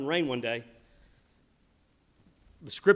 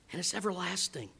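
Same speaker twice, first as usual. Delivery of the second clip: normal talking voice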